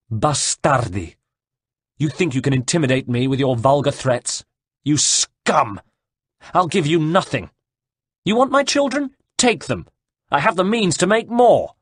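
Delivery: defiant cry. A narrator's reading. Caterina defies the brothers who threaten her children, crying out. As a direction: hurl it down at the enemy, building in pitch and force. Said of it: "she cried".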